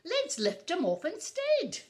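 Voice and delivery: high, loud bird voice; chirpy squawked suggestion; squawking puffin. A narrator's reading. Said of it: "squawked Puffin".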